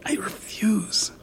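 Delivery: slow unvoiced whisper; hissed whisper. Woman alone refusing to leave, slow whisper. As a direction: refuse under your breath. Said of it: "she hissed".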